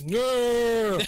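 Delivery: sudden high, loud no; mock shout. Denying being called out, a mock loud no. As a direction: blurt it out like a denial. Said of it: "I was like".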